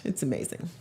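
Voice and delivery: ordinary talk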